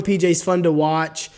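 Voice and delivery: ordinary delivery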